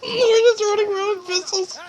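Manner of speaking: high, laughing through the words; laugh-crying. Real, not acted. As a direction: wheeze it out while laughing. Laughing at the silly play.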